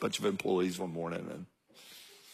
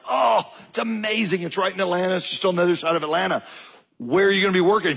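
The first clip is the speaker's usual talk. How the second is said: high, bright-eyed gush of delight; excited dad; 7 semitones higher, 3 dB louder, faster than usual. Retold or imitated. Dad thrilled at daughter's new job, excited. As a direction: burst out thrilled for her. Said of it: "And I'm like".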